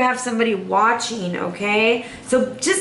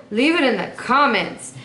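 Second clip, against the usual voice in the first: ordinary delivery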